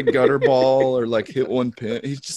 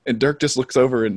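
ordinary delivery

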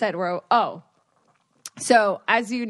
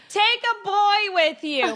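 high, slow mock mom yell; hammed-up high mom voice; mom calling out; 11 semitones higher, 3 dB louder than usual. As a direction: imitate a mother piping up from the background. Mom chiming in from background.

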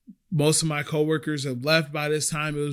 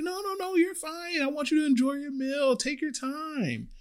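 sweet reassurance; 13 semitones higher, 3 dB quieter than usual. Retold or imitated. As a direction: reassure her sweetly, syrupy high. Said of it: "I'm like".

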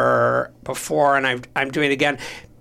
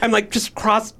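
ordinary talk, nothing marked

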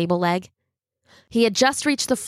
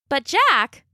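mother voice; 6 semitones higher than usual. A narrator's reading. Mother pleading with son.

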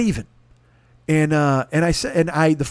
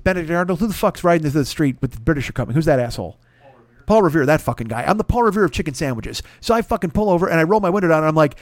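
usual speaking voice

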